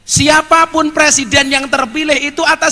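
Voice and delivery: emphatic preaching. Real, not acted. Preacher telling the crowd not to fight over presidents, emphatic preaching. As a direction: proclaim it, then draw out the last words.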